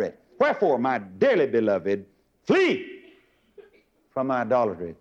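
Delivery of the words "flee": flee shot up very high with a laugh; one word of alarm, laughing; urgent flee. Real, not acted. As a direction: fling out flee like a cry to run. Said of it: "that word flee means take off in a hurry".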